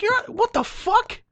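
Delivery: high-pitched, slow mock-outraged retort; incredulous outburst. Driver balking at the tow fee, incredulous.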